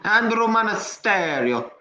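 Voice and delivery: put-on Italian accent. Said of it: "using your Italian accent".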